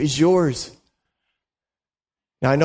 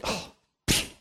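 dismissive scoff; 10 dB quieter than usual. Retold or imitated. Mocking someone else's worship.

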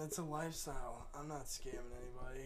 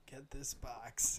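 whispered scammer line; much slower than usual. Retold or imitated. Voicing the scammer's order.

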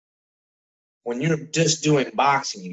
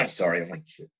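coughing fit, words between coughs; coughing up water; 7 semitones lower than usual. Real, not acted. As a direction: talk through a cough after choking on water. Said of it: "Just gotta drink some water".

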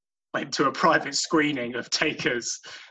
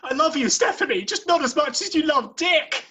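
very high, fast pleading voice; tragic declaration; 14 semitones higher than usual. Acted. Jeff Ross voiced to daughter.

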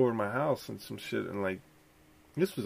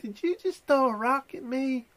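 much higher accusing lady voice; high mock Karen question; 3 dB louder than usual. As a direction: accuse him like the neighbor lady. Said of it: "She's like".

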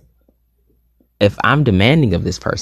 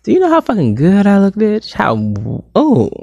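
high-pitched, hammed-up swooning over yourself; mock-orgasmic self-praise; 10 semitones higher, 3 dB louder, slower than usual. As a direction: play at thrilling yourself to climax. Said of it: "I was giving myself an orgasm".

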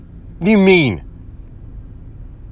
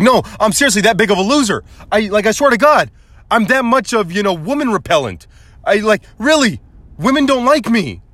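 high-pitched comic pleading; desperate insistence; 8 semitones higher than usual. Retold or imitated. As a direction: swear it, pitched way up. Pleads that he is a loser.

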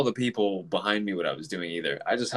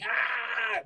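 startled yelp; 13 semitones higher, 7 dB quieter than usual. Retold or imitated. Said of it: "it's so loud that I'm like".

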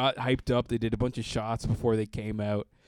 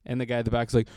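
usual speaking voice

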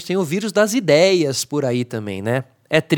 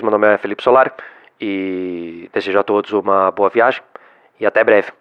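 deep, steady cabin announcement; airline pilot; 8 semitones lower than usual. Shown as a demonstration. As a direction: speak like the captain on the intercom. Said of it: "com voz de piloto".

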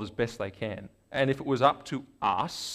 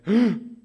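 sudden higher, louder gasp of realization; sharp gasp; 8 semitones higher, 6 dB louder than usual. Retold or imitated. Burke in the corner after a fight, sharp gasp. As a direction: gasp as it hits you.

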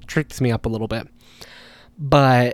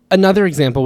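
ordinary talk, nothing marked

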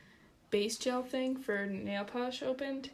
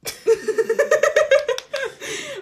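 loud high laugh; 15 dB louder than usual. Real, not acted. Nail dip mishap, both laughing.

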